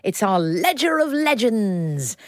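grand announcement. Acted.

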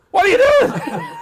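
high, loud yell of disbelief; exasperated shout. Retold or imitated. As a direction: re-enact a dad's knee-jerk outburst. Acting out his first reaction.

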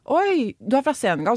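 imitating an excited stranger; mock delight. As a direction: gush like an impressed stranger. Mimicking enthusiastic stranger.

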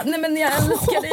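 very high, pleading wail; mock tearful. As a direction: comfort him while falling apart, hammed up. Retold tearful reply to Josef.

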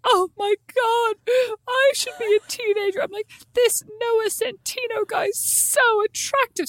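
high, slow swooning gush. Retold or imitated. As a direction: gush like a smitten teenager. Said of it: "I'm literally like".

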